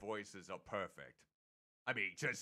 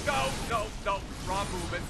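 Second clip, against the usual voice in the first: frustrated; 5 semitones higher, 5 dB louder, faster than usual. Real, not acted. Wrong move in boss fight.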